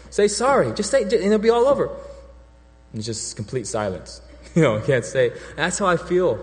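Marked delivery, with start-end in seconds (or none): 0.1-1.9 pleading dad. Retold or imitated. Father urging daughter to apologize.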